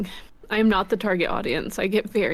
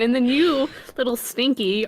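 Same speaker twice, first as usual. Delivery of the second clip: usual speaking voice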